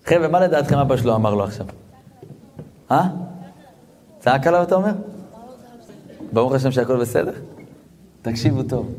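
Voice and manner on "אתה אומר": ordinary delivery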